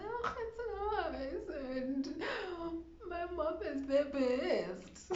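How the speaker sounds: high, soft fake-happy answer, tearful underneath; forced cheer. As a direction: put on a bright face while crying. Now you have to say.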